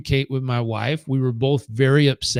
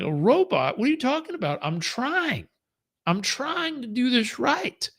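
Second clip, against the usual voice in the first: high, softer pleading indignation; defensive protest; 10 semitones higher, 4 dB quieter than usual. Retold or imitated. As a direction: protest in a high, hurt-husband pitch. Acted quarrel with wife, defensive.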